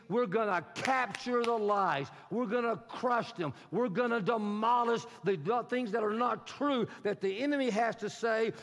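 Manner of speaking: full-force preaching. Acted. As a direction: preach it out with rising fervor to the church.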